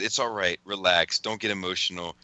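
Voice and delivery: low, calming imitation; mock soothing. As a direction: talk someone down with exaggerated calm. Reading friend's soothing, teasing messages.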